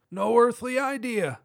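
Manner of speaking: high, light cop voice. Acted. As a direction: pitch the cop way up.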